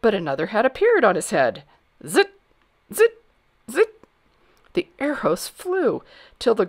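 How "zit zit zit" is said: high, loud, sharp zit sounds; arrow zips. A narrator's reading. Narrator imitating arrows flying at the hat, sharp zips. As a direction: fire off each zit separately.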